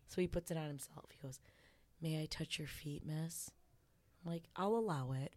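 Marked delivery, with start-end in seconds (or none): 2.0-3.5 low, hushed imitation of a meek servant; submissive request. Client begging to touch his mistress's feet, quietly submissive. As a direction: ask very softly, pitched down.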